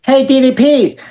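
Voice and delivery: put-on teen tells wrestler he can wrestle; eager fan pitch. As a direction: pitch yourself nervously to your hero. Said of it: "I was like".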